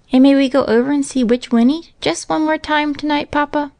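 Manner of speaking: put-on high little-girl plea; Minnie chiming in. A narrator's reading. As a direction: pipe up hopefully in a child's high voice. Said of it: "chimed in Minnie".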